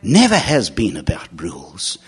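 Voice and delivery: normal talking voice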